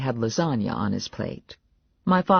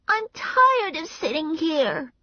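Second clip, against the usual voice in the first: put-on very high child voice; high-pitched little-kid complaint; complaining little girl; slower than usual. A narrator's reading. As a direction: whine like a small girl.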